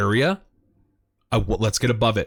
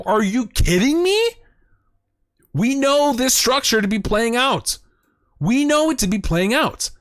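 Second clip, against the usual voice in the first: higher, fired-up disbelief; can't contain the hype over the chart; genuinely excited, voice pitched way up; real excitement; 10 semitones higher than usual. Real, not acted. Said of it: "Okay, I'm getting excited".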